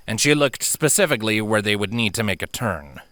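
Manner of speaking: ordinary delivery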